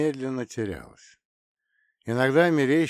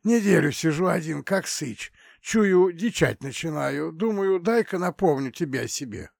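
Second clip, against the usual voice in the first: hoarse voice; 7 semitones higher than usual. Acted. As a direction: rasp it out with a lost voice. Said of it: "Голос был осипшим, свистящим".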